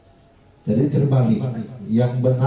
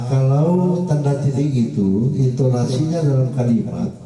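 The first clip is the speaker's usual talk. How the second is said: ordinary talk, nothing marked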